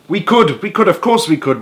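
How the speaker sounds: high, excited, mildly shouted chorus; eager shout. A narrator's reading. Cleaners answering Duke's apple request, eager mild shout. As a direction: call out eagerly, all at once. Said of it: "we all shouted".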